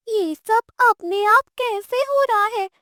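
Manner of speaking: put-on high, amazed child's question; a little girl gasping at magic; wide-eyed amazement. Girl asks the witch about the magic, high and amazed. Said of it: "आंखें फैलाकर देख रही थी".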